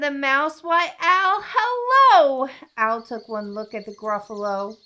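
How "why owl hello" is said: high, loud sing-song hello; exuberant greeting. A narrator's reading. Mouse hails owl before Gruffalo, high and exuberant. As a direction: call out with showy delight.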